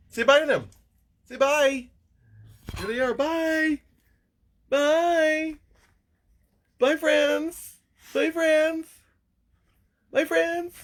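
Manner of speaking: high squeaky voice for the dog; baby talk goodbye. Pretend. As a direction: wave goodbye in a tiny voice for the dog. Dog says bye to viewers.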